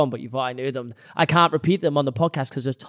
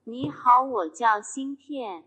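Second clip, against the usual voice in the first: higher, slow Mandarin phrase; speaking Mandarin; 6 semitones higher, 4 dB quieter, much slower than usual. Shown as a demonstration. Showing friends his name in Chinese, slow Mandarin delivery. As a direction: say it slowly with Mandarin tones.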